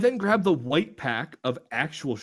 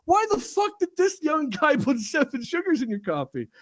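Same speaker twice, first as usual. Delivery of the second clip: high, loud mocking disbelief; mocking coworkers; 8 semitones higher, 3 dB louder than usual. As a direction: rib him in front of everyone. Coworkers mock the young guy.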